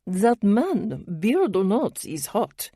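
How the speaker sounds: lightly voiced French woman; thick French accent. A narrator's reading. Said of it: "with her thick French accent".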